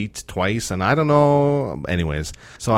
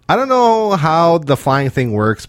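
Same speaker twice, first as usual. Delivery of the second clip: ranting; 6 semitones higher, 4 dB louder, slower than usual. Acted. Opening a rant.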